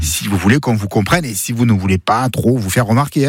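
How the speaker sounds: normal talking voice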